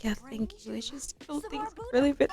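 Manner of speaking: soft, high, breaking into mock crying; hushed, put-on weepy voice; acted tearful. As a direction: play her choking up. Voices tearful woman confiding, cry heard.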